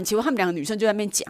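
ordinary talk

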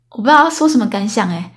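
usual speaking voice

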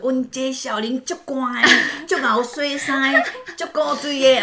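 high, slow sweet-talking in Taiwanese; cooing over a cute kid; coaxing neighbor. Retold or imitated. Neighbor praising the little girl to win her over, high and slow.

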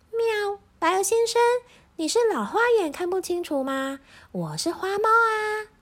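very high meowing cat voice; puzzled cat. A narrator's reading. Cat questioning the goose, puzzled and high. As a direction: ask in high-pitched bewilderment. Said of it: "它疑惑地问".

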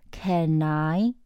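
"Can I" joined as one word, slow; slow "Can I" with the n carried over; linked sounds. Shown as a demonstration. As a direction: link "Can" into "I". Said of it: "也要做连音".

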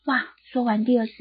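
ordinary delivery

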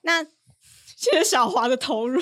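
slow words broken by laughter; can barely talk for laughing. Real, not acted. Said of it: "是不是笑到讲不出话".

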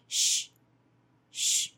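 sh as a breathy hush; sh sound. Shown as a demonstration. Teacher showing sh to students, unvoiced. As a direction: hiss out sh. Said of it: "the sound sh".

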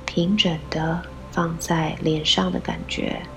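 usual speaking voice

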